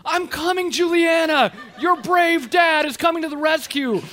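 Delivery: high, loud mock-heroic shout; hammed-up brave dad battle cry; heroic calling out. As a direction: holler it like a rescuing hero. Dad calls down slide to daughter.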